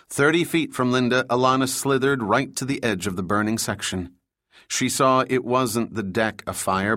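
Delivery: usual speaking voice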